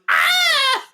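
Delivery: a loud, high, laughing mock scream of pain; hammed-up scream. Recapping Charles getting hit by the bullet, mock scream. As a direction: shriek like you've been shot, half laughing.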